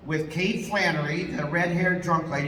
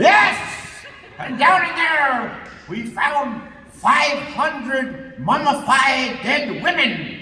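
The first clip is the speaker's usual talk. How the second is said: higher, slow, drawn-out boast; slow mock explorer; 6 semitones higher, 3 dB louder, slower than usual. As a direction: stretch each word for suspense.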